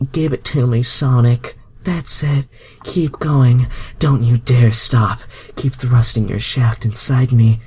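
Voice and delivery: high, loud, moaning female voice; breathless high moaning plea; moaning Rogue. A narrator's reading. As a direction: cry it out high in pleasure. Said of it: "Rogue moaned".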